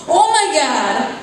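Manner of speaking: woman finds a stranger in her kitchen, shouts in alarm; startled shout. Acted. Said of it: "Autumn shouts upon seeing me".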